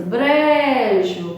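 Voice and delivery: "Brejo" with a drawn-out BRE; "Brejo", long BRE then short JO; emphasis on BRE. Shown as a demonstration. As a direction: hold the first syllable of "Brejo". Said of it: "você dá mais aquela ênfase".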